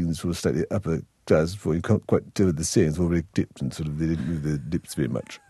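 clipped upper-class English, lips barely moving; mock royal English accent, mumbled through still lips. Shown as a demonstration. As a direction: mimic the royals' tight-lipped posh accent. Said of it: "the way they speak".

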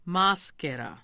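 "Maschera" with a firm stress on the first syllable; careful Italian "Maschera", ma stressed; accent on MA. Shown as a demonstration. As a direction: demonstrate "Maschera", leaning on ma. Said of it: "you want the accent on the ma".

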